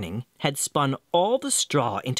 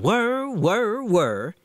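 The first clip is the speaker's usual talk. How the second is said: spinning whir; 7 semitones higher, much slower than usual. A narrator's reading. Spinning wheel sound.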